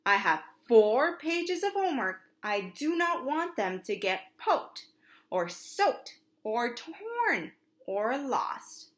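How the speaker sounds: slow, firm and deliberate, like explaining to toddlers; spoke slowly. Acted. Big brother slowly explaining to the toddler twins, slow and deliberate. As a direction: lay it out word by word, slowing to a crawl. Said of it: "Oliver spoke slowly to the twins".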